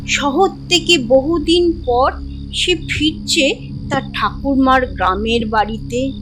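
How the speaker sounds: ordinary delivery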